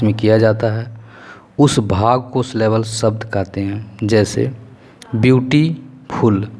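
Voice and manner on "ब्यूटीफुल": ब्यूटीफुल broken slowly as "beauty - ful"; slow teacherly split of ब्यूटीफुल; split into syllables. Shown as a demonstration. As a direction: say ब्यूटीफुल in two separate parts, ब्यूटी, then फुल. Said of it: "तो ब्यूटी plus फुल मतलब दो syllable".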